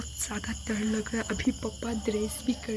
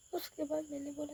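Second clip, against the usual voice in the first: usual speaking voice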